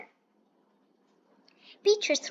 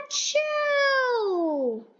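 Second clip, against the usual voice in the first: sneeze; 10 semitones higher than usual. Acted. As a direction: burst out a big sneeze. Sneeze in story.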